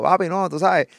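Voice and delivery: lightly imitating a rapper's slang; street swagger. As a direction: toss it off like a cool guy. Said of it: "con su piquete".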